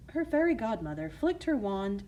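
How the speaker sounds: plain reading voice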